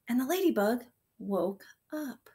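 ordinary delivery

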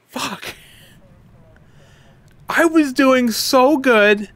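high, slow whiny complaint; frustrated lament. Real, not acted. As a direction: drag it out in disappointment. Lamenting game death.

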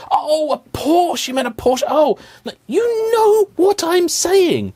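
very high, slow, singsong oh a Porsche; mock dawning realization. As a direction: ham up the penny dropping. Mock understanding listener.

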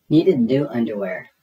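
ordinary delivery

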